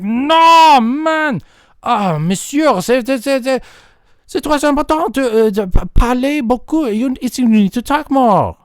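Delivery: high, exploding protest; hammed-up exasperation, pitched high; exasperated. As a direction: burst out, then plead insistently. Exasperated reply to misunderstanding friend.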